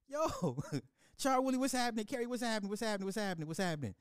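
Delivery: high-pitched, fading singsong; high playful greeting. Real, not acted. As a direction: greet in a squeaky voice.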